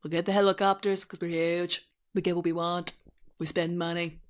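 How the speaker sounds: monotone synthetic presidential impression; deadpan robotic boasting; Trump. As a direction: parody politician in an even, AI-like delivery. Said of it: "sounds like a Trump A I voice".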